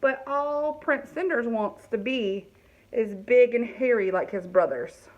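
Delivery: usual speaking voice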